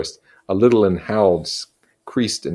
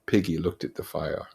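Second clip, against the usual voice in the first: plain reading voice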